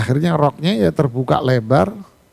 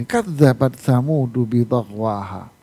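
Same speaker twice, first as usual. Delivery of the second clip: Quran recitation; 4 semitones lower than usual. Acted.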